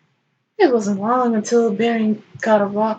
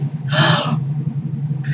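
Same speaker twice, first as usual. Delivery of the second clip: breathy gasp; 7 semitones higher, 4 dB quieter than usual. Acted. Climax gasp in story.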